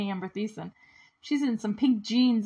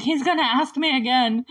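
higher, louder retold panic; playful panicky quote, raised in pitch; mock freaking out; 4 semitones higher, 6 dB louder than usual. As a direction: lightly imitate someone starting to freak out. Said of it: "she's freaking out about this".

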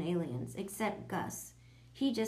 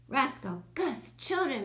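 louder, higher teacher's scolding call; 6 semitones higher, 4 dB louder than usual. A narrator's reading. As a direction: call unruly pupils sharply to order. Teacher calling rowdy class to order.

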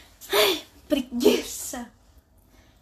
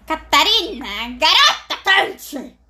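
loud, slow showman announcement; loud announcer; 8 dB louder, slower than usual. Acted. Announcing the girl on fire, loudly. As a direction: proclaim it like a hype man. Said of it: "vou repetir, calma aí".

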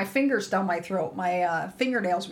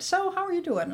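mock sing-song greeting; 10 semitones higher than usual. Provider's friendly question retold.

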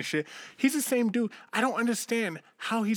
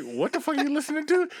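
incredulous friend; 12 semitones higher than usual. Retold or imitated. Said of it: "He's like".

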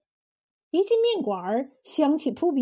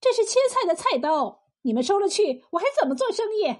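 higher-pitched, self-assured objection; righteous protest; 6 semitones higher, faster than usual. Acted. Cook protests the seizure of her working knife, confidently indignant. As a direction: argue back, sure of being right. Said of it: "理直气壮地说".

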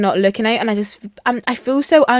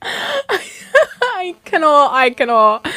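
helpless, slowed words through laughter; real laughter; 6 semitones higher, much slower than usual. Real, not acted. Co-host reacting to friend pushing someone over, helplessly laughing. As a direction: crack up and barely get it out.

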